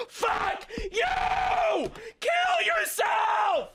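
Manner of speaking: very high, drawn-out furious scream; screaming rage. Real, not acted. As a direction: shriek it with full fury. Raging at cringe fans.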